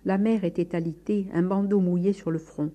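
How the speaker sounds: usual speaking voice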